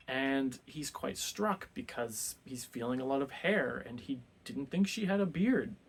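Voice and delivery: normal talking voice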